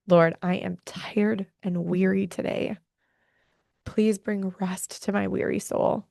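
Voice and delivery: slow, hushed whisper to God; a weary whispered morning prayer. Pretend. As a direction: whisper the prayer slowly, kneeling. Voicing a morning prayer on knees.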